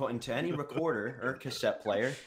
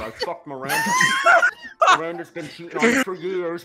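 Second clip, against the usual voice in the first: high mocking yell, breaking into laughs; cursed tape yelling; 5 dB louder than usual. Cursed tape yelling at a freshly divorced researcher, mock shriek. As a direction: shriek the insults like a gloating tape. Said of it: "This is what the tape said".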